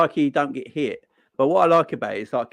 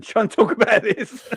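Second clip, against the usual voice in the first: laughing quote; 7 semitones higher, 3 dB louder, slower than usual. Retold or imitated. Quoting the boss, laughing.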